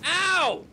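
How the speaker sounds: sharp high yelp of pain; hit hard crying out. Real, not acted. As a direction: yelp as if struck. Bitten in game.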